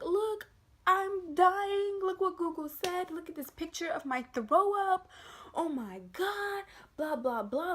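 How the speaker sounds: hammed-up high, loud panic; freaking out. Telling mom she's dying, high exaggerated panic. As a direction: plead in a high, frantic mock wail. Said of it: "Like, I'm freaking out, you guys".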